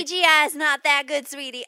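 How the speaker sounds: put-on Southern accent, loudly setting someone straight. Margot Robbie's mom answering her daughter, loud Southern accent. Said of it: "why she has a southern accent".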